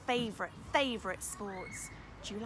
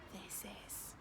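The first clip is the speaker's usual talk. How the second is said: whisper; 14 dB quieter than usual. Real, not acted. Teasing reveal to children.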